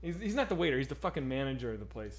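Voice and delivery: ordinary delivery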